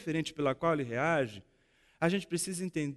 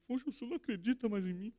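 hurt Peter; 6 semitones higher, 9 dB quieter, much faster than usual. Retold or imitated. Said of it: "magoado com Jesus".

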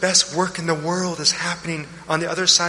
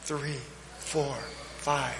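slow breath counting; 2 semitones lower than usual. Shown as a demonstration. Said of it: "Try it with me".

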